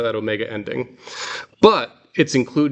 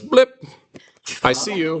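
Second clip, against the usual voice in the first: radar blip; 6 semitones higher than usual. Shown as a demonstration. Teacher imitating radar blip sound. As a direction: chirp blip high like a radar hit. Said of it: "it goes".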